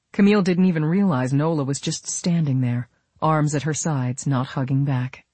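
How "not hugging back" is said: ordinary delivery, nothing marked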